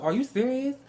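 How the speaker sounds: high-pitched incredulous question; indignant disbelief. Retold or imitated. Customer in a long line at idle staff, indignant. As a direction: lift the pitch as if appalled.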